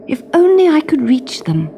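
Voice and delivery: high, longing princess; wistful princess wish; cartoon princess. A narrator's reading.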